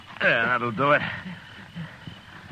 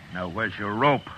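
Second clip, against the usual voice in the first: ordinary delivery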